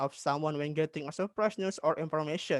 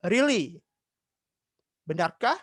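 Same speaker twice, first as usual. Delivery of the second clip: raised, louder first word, mild acted surprise; surprised question; 4 dB louder than usual. Teacher replaying the girl's reply to news, acted surprise. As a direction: question the news, then echo it. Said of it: "Ratu terkejut dan dia meng".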